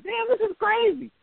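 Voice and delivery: high, stunned disbelief; amazed. Retold or imitated. Reliving watching the runback, high and stunned. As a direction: pitch it up in shock. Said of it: "I'm like".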